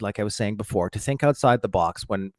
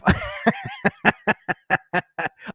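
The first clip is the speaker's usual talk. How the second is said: hard laugh; 10 semitones higher than usual. Real, not acted. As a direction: burst of real high laughter. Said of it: "I laughed so hard".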